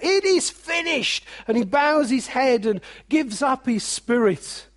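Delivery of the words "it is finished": high ringing cry; a lifted, ringing shout of completion; cry of triumph. Acted. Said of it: "Jesus cries out".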